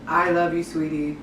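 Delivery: a lifeless I love you, sweetie; flat wrong way. Shown as a demonstration. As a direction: say it with no feeling at all. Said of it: "is not the way to go".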